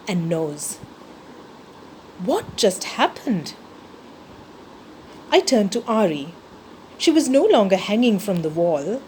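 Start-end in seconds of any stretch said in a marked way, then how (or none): none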